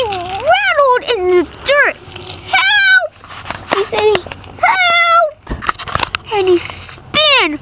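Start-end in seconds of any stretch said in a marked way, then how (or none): 2.5-3.1 high, loud cry for help; shouted call for help. Acted. Character calls for help. 4.7-5.4 calling for help. Acted. Character calls for help.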